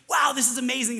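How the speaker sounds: loud, high burst of delight; joyful outburst. Acted. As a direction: erupt in joy at the win. Preacher acts joyful sports fan.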